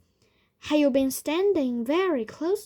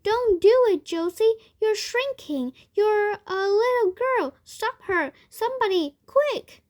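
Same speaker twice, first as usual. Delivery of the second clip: high, loud cries of alarm; frantic, urgent warning; panicked shouting; 10 semitones higher, 7 dB louder than usual. A narrator's reading. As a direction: yell for someone to help. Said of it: "shouted Grandpa Joe".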